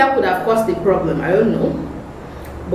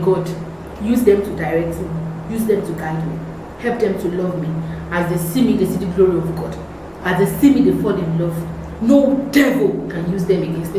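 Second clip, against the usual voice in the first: praying; 3 semitones lower than usual. Retold or imitated. Prays aloud within testimony.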